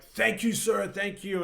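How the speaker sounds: high, eager thanks from a starving dwarf; grateful dwarf. Acted. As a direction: blurt the thanks, overjoyed. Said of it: "he's like".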